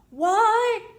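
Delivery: high, loud yell across the distance. A narrator's reading. Boy yells to conductor on moving train, high and loud. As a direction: shout it after the departing train. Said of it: "I yelled out".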